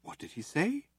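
soft, high, hushed disbelief; astonished whisper. A narrator's reading. Ford whispering to Zaphod, astonished. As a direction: breathe it in shock. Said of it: "whispered Ford in astonishment".

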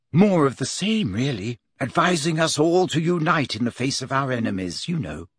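put-on high, lightly girlish voice, dropping at the end; Hermione, explaining. Hermione reports the hat's song to Harry, matter-of-fact.

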